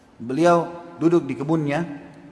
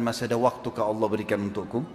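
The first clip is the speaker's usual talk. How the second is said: low, deflated plea; quiet, humbled retold question; weakened, subdued; 6 semitones lower, 4 dB quieter than usual. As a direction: ask as if the strength has drained out of you. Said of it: "Tiba-tiba dia lemas".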